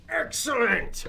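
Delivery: high, loud triumphant cry; excited shout. Acted. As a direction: grab the win loudly. Water finally offered.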